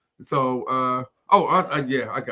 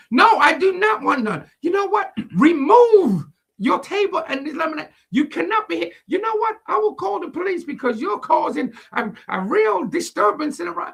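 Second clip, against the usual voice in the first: hammed-up Karen ordering the kid off; shrill-pitched mock outrage; 12 semitones higher than usual. Karen voiced threatening police.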